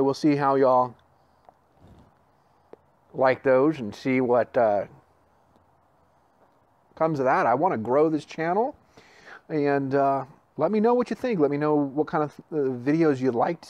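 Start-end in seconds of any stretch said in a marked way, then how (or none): 0.0-7.5 talking around pipe stem; speaking with pipe clenched; pipe in mouth. Real, not acted. Seen 1: Pipe stem held between lips throughout; removed by 5.3 s.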